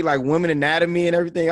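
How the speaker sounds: ordinary talk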